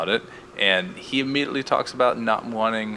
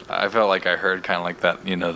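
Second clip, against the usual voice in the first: usual speaking voice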